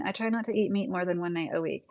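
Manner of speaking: ordinary delivery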